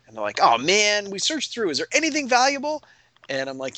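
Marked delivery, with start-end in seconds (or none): 0.4-2.8 eager player. Retold or imitated. Players hoping for loot in ransacked shop.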